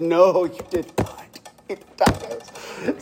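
slow and hushed, the last words nearly whispered; mock grave warning. Pastor teasing Salam about the tacos, slow hushed mock warning. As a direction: tease him with a grave secret.

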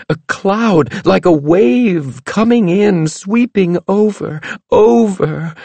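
high, slow ominous trance voice; fortune teller. A narrator's reading. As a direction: chant the vision with dread. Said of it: "the fortune teller grimaced".

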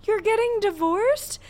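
high pleading fangirl voice; begging fangirl. Retold or imitated. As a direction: plead like a lovesick fan. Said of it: "signs that was like".

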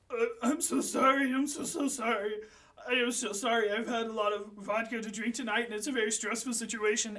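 a tipsy bartender begging forgiveness; apologetic drunk Tony. Acted. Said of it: "and Tony apologizes".

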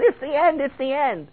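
high-pitched mock panic; high, hammed-up wail of doom; crying like little girls. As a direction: squeal it like frightened little girls. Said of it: "crying like little girls".

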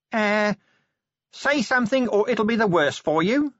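high, drawn level voice; Nobby, tentative threat. A narrator's reading. Nobby prompts the silent cube, high-pitched and tentative. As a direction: pipe it up high like a hapless guard.

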